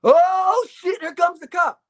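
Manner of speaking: high, slowed nervous whisper-panic; scared boyfriend as dad approaches; panicked. Retold or imitated. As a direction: dread the footsteps.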